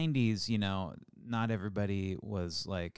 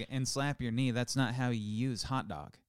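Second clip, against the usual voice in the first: ordinary talk, nothing marked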